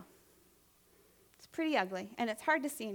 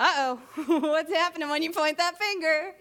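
loud, playful uh-oh with a laugh; laughing tease; 3 semitones higher, 8 dB louder than usual. Real, not acted. Preacher teasing congregation about pointing a finger, laughing. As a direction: tease the room loudly through a chuckle.

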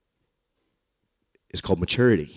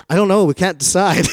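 high, loud helpless whine; whiny kids answering; 9 semitones higher, 6 dB louder than usual. Retold or imitated. Two boys answer the mom, high helpless whine.

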